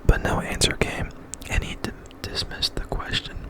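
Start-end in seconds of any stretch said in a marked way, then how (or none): none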